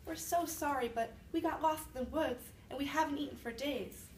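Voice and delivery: put-on high, sorry little-kid voice; children apologizing to the old woman, pleading. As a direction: play the hungry lost kids begging. Witch voices the lost children.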